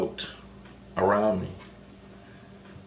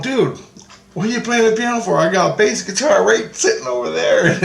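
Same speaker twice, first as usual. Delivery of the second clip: excited, laughing; 10 semitones higher, 3 dB louder than usual. Retold or imitated. Mocking Jesus for playing piano.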